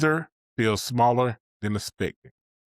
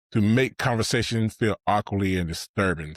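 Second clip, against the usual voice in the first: normal talking voice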